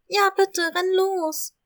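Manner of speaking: high, loud scared kitten cry; frightened cry. Acted. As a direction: yell it out in panic. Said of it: "schrie Kathy vor lauter Angst".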